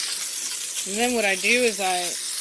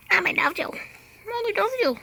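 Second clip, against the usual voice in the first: very high, squeaky cooing; 12 semitones higher, faster than usual. Real, not acted. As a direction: smother a pet with high-pitched affection. Baby talk to her animal.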